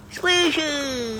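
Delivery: high sing-song call. Real, not acted. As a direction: call her name from across the yard. Calling chipmunk again.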